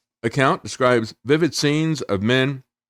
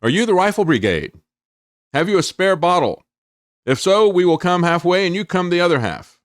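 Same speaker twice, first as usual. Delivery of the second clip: mild mock shout, pitched higher; retold shout; 7 semitones higher than usual. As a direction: call it out like a soldier, without full force. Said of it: "and they shouted out".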